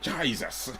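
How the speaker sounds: mock Irish with a wide vowel; Irish accent. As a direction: put on a playful Irish accent, JYE-zuss. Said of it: "That sounds Irish a little bit".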